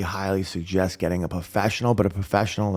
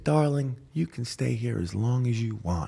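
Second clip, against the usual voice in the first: softer, tender imitation of a mother; motherly reassurance; 3 semitones higher, 4 dB quieter than usual. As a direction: gently welcome someone in. Friend's mother takes him in.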